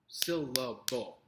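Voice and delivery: "Syllable" broken slowly into three beats. Shown as a demonstration. Teacher counts out syllables for the class, "Syllable" split slowly in three. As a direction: say "Syllable" as syl-la-ble, each part apart. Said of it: "Three syllables".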